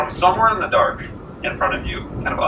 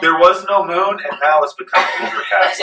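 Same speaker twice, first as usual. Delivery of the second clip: ordinary talk, nothing marked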